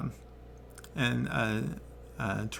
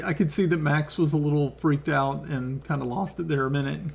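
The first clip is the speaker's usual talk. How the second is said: normal talking voice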